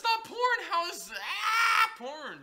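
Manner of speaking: high, loud, dragged-out protest; indignant shout. Real, not acted. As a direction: protest loudly and slowly. Arguing it isn't porn.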